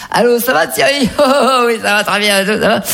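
high, gushing phone hello; imitated effusive greeting. Husband on the phone to his friend, high effusive imitation. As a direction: greet an old pal with mock delight.

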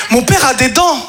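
normal talking voice

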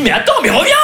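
high-pitched pleading call; retold calling out. Man calls back fleeing girlfriend, high mock cry. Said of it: "Alors je lui crie".

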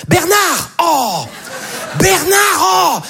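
very high-pitched mock yell after a child; mother yelling. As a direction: call out like an exasperated mother chasing her kid. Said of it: "un prénom qui est cool à gueuler".

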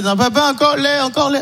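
put-on child pleading, high; high baby talk; toddler voice. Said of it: "C'est vrai".

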